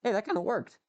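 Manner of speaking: high, cracking voice. Real, not acted. Streamer pleased that a test kinda worked, real voice crack. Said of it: "Ooh, voice crack".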